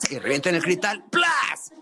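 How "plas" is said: imitated glass smash. Co-host picturing patrols smashing shop windows, high mock crash. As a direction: mimic a shop window smashing in one burst. Imita el cristal que revienta.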